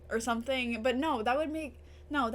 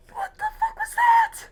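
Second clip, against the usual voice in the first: loud, much higher panicked yell; shocked screaming; 9 semitones higher, 6 dB louder than usual. Real, not acted. Viewer watching Joyce die, panicked screaming. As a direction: scream at the screen in disbelief.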